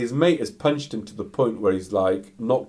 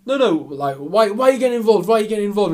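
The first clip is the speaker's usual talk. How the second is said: loud, high, rapid-fire challenge; acted agitated protest; 11 semitones higher, 7 dB louder, faster than usual. Customer warns off the man stepping in, acted agitation.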